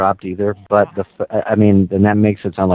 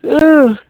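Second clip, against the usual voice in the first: disgusted groan; 6 dB louder than usual. Acted. Disgusted at podcast description.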